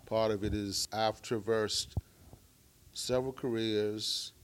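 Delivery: usual speaking voice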